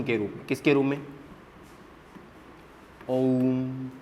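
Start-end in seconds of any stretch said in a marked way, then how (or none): 3.1-3.9 "ओम" with the ओ held three times long; soft chant-like "ओम", long ओ; prolonged Om. Shown as a demonstration. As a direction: draw out the ओ of "ओम" slowly and softly. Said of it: "बोल के देखिए".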